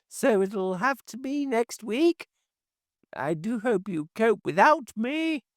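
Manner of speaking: put-on high, thin, reedy old woman's voice; a fussy neighbour calling through the letterbox, piping high. A narrator's reading. Neighbour calling through the letterbox to Hashiva, high and reedy. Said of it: "thin and reedy".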